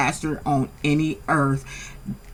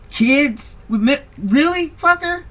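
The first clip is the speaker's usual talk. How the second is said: incredulous; 7 semitones higher than usual. Real, not acted. Incredulous outburst at character.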